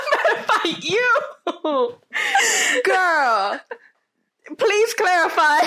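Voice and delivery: high-pitched, laughing mock outrage; laughing disbelief. Real, not acted. Friend reacting to a too-quick love confession, laughing disbelief. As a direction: demand answers through helpless laughter.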